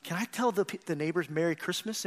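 put-on quiet, timid child's ask; shy boy asking his dad in a hushed voice. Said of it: "he kinda cowers a little bit".

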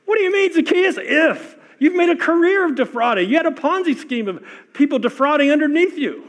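high, loud, teasing disbelief; mock incredulous. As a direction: challenge him like you can't believe the nerve.

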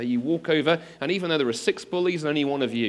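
ordinary delivery

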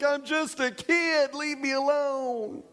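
put-on high slow pleading kid voice; mock pleading from the overwhelmed young ref; whiny plea. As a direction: beg like a scared fifteen year old. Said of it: "I'm like".

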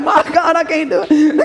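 a scared private giving up; hammed-up nervous private; nervous recruit. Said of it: "he's all nervous".

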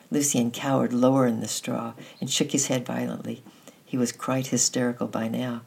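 usual speaking voice

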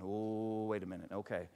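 very low, soft "oh"; hushed concern. Retold or imitated. Husband noticing his wife's exhaustion, hushed and low. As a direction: back off quietly and take notice.

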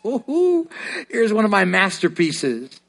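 high delighted "Ooh" of a proud maker; a proud creator marvelling at his work; God admiring. Retold or imitated. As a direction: gush over a masterpiece you just made. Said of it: "he looked at us and said".

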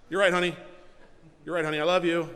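quick placating admission; said real fast. Shown as a demonstration. Husband conceding to his wife, said real fast. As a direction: get it out fast and be done. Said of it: "Say it real fast".

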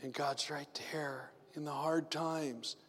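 soft unvoiced reassurance; whispering. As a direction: whisper it as a comfort. Whispered after the prayer.

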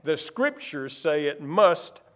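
the word must slowed and held; stress on must. Shown as a demonstration. Preacher pointing the congregation to must, held slow. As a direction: lean on must, drawn out. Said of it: "Did you catch that word?".

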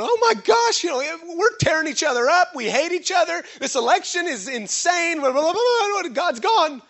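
high, loud, hammed-up panic; mock freak-out. Preacher imitating a church panicking over the election, mock freak-out. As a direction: babble it like a frantic crowd, pitch way up. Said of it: "We're all freaking out 'cause we're going".

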